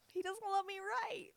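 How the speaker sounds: high, loud cry of real hurt; tearful outcry. Real, not acted. Crying, repeats it out loud.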